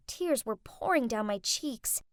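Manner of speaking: ordinary delivery, nothing marked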